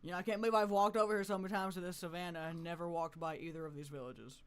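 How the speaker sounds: usual speaking voice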